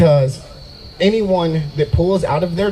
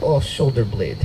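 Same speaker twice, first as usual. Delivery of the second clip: put-on Russian accent; 5 semitones lower, 4 dB quieter than usual. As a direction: lower Russian-accented girlfriend impression. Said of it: "used to date this Russian girl".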